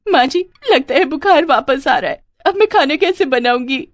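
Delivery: high-pitched put-on crying; tearful wailing. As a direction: whine through fake sobs to dodge cooking. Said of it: "ये सुनते ही शकुंतला रो पड़ी". (रोने का नाटक, ऊँची आवाज़)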